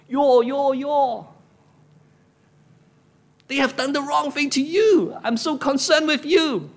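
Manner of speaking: high, forceful grievance, leaning hard on "your"; grumpy complaint. Acted. Preacher as Elijah reporting Israel's wrongs to God, grumpy complaint. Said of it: "I speak for him like that".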